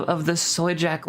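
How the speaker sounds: ordinary talk, nothing marked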